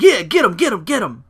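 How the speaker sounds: lightly voiced cocky heckler; egging him on. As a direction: cheer on a fight you won't join. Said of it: "Miz is like".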